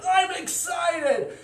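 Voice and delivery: high, loud, slow squeal of glee; excited dragon shout. Acted. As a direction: stretch it out, thrilled. Dragon sees the rainbow.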